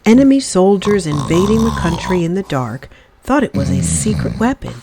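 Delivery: plain reading voice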